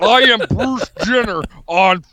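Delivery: mock strongman declaration; Bruce Jenner on steroids. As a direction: overblown tough-guy impression. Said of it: "You sound like Bruce Jenner doing Jesse Ventura".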